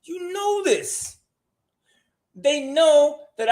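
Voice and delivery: very high, slow Chris Tucker squeal; Chris Tucker impression. Host mimics Chris Tucker to listeners, very high and slow. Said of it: "Sound like Chris Tucker".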